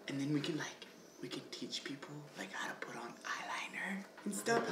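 whispering. Acted. Sharing a secret makeup idea.

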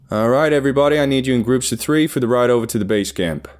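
loud, high-pitched call to the group; boss calling out. A narrator's reading. Boss gathering everybody for the ride to base camp, calling out. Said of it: "I heard my boss call".

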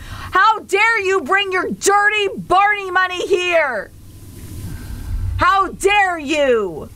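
high, drawn-out indignant cry; mock outrage. Streamer to chat over paid Barney jokes, mock outrage. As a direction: stretch each word in theatrical indignation.

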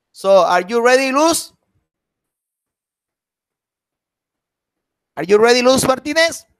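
loud, high-pitched roll call. Real, not acted. Teacher checking whether student is ready, calling out. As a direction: call out the question, voice lifting high.

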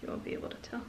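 hushed, secretive whisper. Real, not acted. Prankster quietly setting up the trick, whispering. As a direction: whisper it to the camera.

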